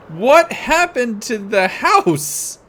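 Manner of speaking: shocked. Real, not acted. Shocked at destroyed house.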